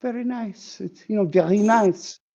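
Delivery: high, dainty poodle reply; prissy poodle. Acted. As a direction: purr it like a pleased lady dog. Poodle replies in the joke.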